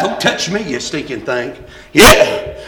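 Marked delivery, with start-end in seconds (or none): 0.0-1.5 low, muttered mock recoil from a hug; mock Baptist. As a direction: grumble it like a stiff Baptist. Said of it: "how the Baptists are about hugging".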